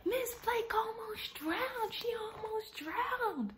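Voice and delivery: high, drawn-out mock yell at normal volume; imitated kids yelling. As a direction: call it out slowly in a squeaky kid's pitch. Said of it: "kids started yelling out".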